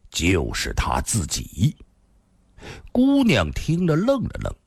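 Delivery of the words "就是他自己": low, slow reveal; old grandfather. A narrator's reading. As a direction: drop the answer slowly as a grave old man. Old man reveals the answer. (低沉缓慢地揭晓)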